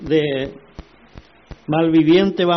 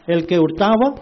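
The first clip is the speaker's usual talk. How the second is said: ordinary talk, nothing marked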